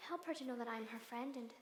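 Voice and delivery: soft, earnest request to God; quiet prayer. Acted. Governess praying for the girl's trust, soft prayer voice. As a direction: ask humbly, voice dropped.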